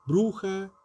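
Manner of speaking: ordinary delivery, nothing marked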